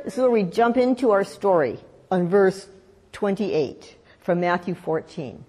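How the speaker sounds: ordinary talk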